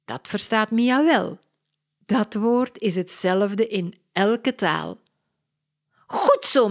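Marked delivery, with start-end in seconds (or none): 6.1-6.7 higher, louder cheering. Acted. Mia cheers the girl on TV, delighted. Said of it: "roept ze".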